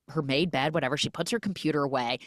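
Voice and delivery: normal talking voice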